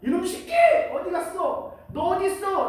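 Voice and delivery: high-pitched mock scolding; imagined angry God. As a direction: act out hunting down a culprit. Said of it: "저는 처음에 이렇게 상상이 갔어요".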